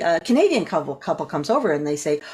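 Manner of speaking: usual speaking voice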